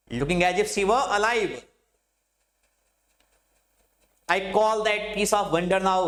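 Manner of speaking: slow, measured reading of verse; slow classroom poetry recital; poem recitation. Acted. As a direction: read the lines slowly, savouring each phrase.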